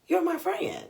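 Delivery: high-pitched stress on "friend"; emphatic appeal. Retold or imitated. Confronting her friend about coming at her, emphatic "friend". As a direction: pitch "You're my friend" high, landing hard on "friend". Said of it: "I kept putting emphasis on that".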